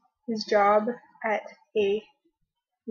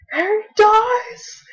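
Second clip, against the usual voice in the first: high, loud, stretched-out mock yell; imitated scream; 10 semitones higher, 10 dB louder, much slower than usual. Imitating someone in our group at the theater, screamed. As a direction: belt it out slowly like the friend who spoiled it.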